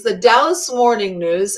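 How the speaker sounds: ordinary talk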